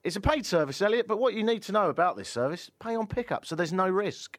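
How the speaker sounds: bright high ad voice; hammy radio ad pitch; ad read. Acted.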